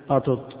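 "قطط" as qatut, both ta sounds separate; "قطط" pronounced qa-tut; underlying unmerged form. Shown as a demonstration. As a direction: split the doubled ta of "قطط" into two syllables. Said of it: "أصلها يعني الحرف".

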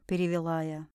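plain reading voice

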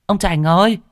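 high, loud call rising at the end. Acted. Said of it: "tiếng vợ ở trên đê oang oang".

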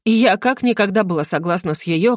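ordinary delivery

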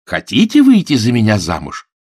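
упрямо повторяет предложение, с нажимом на «выйти»; давит, не отступая; настойчиво, громче. Acted. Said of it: "снова повторил Сэм". (настойчиво и громко, ударение на «выйти»)

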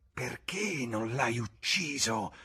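slow hissed question from the bar woman. A narrator's reading. Bar woman hissing at James, slow and venomous. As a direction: lean in and hiss it slowly. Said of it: "sibilò".

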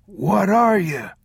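put-on high, slow old traveler; wary old man. A narrator's reading. Old traveler questioning the strangers, high slow old-man voice. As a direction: challenge them in a high, drawn-out voice.

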